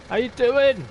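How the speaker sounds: bouncy showman welcome; big hammed-up greeting. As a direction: throw a big cheery hello at the viewers. Greeting repeated, much bigger.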